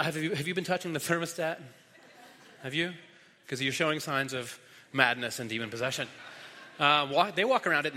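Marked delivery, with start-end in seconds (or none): 0.0-5.7 teasing question through a laugh; quick, soft, laughing mock interrogation; mock accusation. Preacher jokingly quizzing his kids, laughing.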